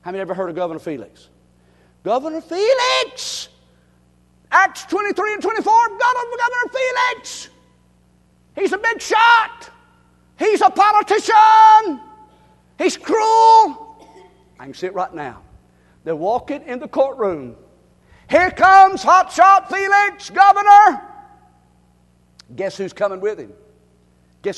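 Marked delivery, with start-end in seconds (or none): 2.0-14.1 high, loud preaching cry; proclaiming shout. Real, not acted. 18.3-21.1 high, loud, slow announcer cry; mock herald.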